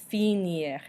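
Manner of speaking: finir as fee-NEER, French style; final R sounded. Shown as a demonstration. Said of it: "those I's that sound like E".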